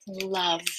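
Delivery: "loved" with the final D clearly sounded; -ed ending sounded. Shown as a demonstration. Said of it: "you have to say that sound to make sure people know".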